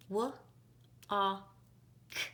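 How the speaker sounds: W-A-K tapped as three separate sounds; tapping sounds. Shown as a demonstration. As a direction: tap out W-A-K one sound at a time. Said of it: "pound and tap walk".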